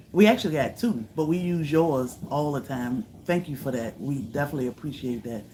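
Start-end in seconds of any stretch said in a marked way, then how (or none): none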